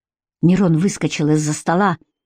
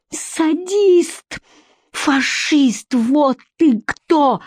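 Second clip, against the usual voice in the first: high, drawn-out cries of outrage; outraged accusation; 8 semitones higher, much slower than usual. A narrator's reading. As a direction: hurl each insult at your captor. Vika hurls insults at captor.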